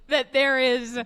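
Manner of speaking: high burst of laughter into words; laughing through the line. Real, not acted. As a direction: crack up mid-sentence. Laughing while praying joke.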